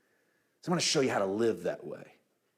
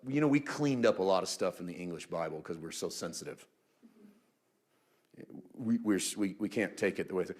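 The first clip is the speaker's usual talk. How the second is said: ordinary talk, nothing marked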